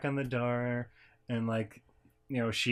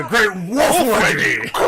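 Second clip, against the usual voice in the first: loud, slow, booming title call; hammed-up big reveal; announcer shout; 8 semitones higher, 11 dB louder, slower than usual. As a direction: announce it like a wrestling move. Dramatic announcing the wedgie move.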